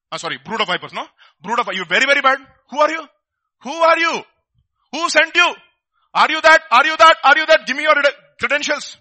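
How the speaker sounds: loud, high, hammering demands; mock Pharisee interrogation. Pharisees' envoys interrogating John, loud and accusing. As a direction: grill him like a suspicious official. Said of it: "The people who came, sent from Pharisees".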